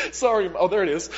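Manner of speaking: sorry said through a laugh; sheepish laughing sorry; laughing apology. Real, not acted. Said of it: "We're having technological issues".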